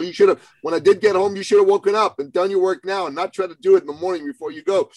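high, fast, heated lecture to a son; retold angry scolding. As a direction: rattle it off like an annoyed dad. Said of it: "I was pissed at him".

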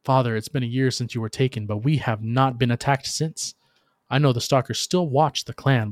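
plain reading voice

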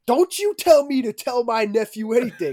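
loud, high retold outburst; indignant uncle. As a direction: cut in like an offended uncle. Uncle cuts in, acted quarrel.

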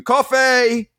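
high call for coffee. Acted. Mild mock shout. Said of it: "shouting out".

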